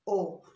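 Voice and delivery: O said open-mouthed as one pure vowel; open Spanish O. Shown as a demonstration. Teacher models the vowel for learners, open pure O. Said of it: "you should open your mouth".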